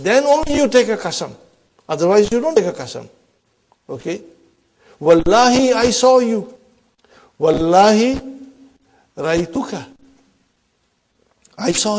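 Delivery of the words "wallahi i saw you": higher, louder swearing of the oath; emphatic oath. Shown as a demonstration. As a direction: swear it loudly, like an accusation. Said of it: "example of swearing an oath".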